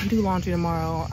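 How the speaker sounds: ordinary talk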